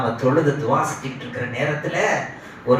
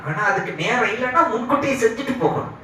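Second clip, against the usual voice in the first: ordinary talk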